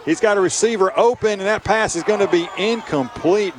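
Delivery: excited call. Real, not acted. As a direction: call the pressure loud and high. Pressured pass, live radio call.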